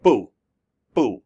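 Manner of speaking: BU with a quick drop in pitch; sharp falling BU; fourth tone. Shown as a demonstration. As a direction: say BU short and falling, like an angry word. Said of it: "on this fourth tone".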